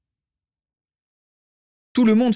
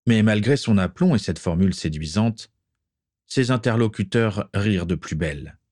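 usual speaking voice

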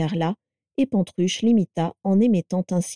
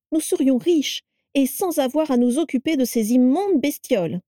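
high, eager persuasion; insistent pleading; 7 semitones higher than usual. A narrator's reading. Carla urges Vera to sell the animals, insistent. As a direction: press the case in a raised pitch.

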